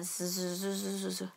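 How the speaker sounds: "스스스스스스" halfway between s and z; in-between s sound. Shown as a demonstration. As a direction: hold a buzzing s-z sound. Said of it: "시옷과 지읒의 중간 발음".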